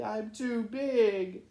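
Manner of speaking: slow, downcast big bird voice; sorrowful, hanging his head; sad. Acted. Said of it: "he said sadly".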